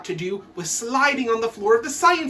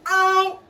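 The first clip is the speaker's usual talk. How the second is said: high ow; high yelp of pain; 9 semitones higher, 5 dB louder than usual. Retold or imitated. Pupil caught, high yelp. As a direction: yelp as if hurt.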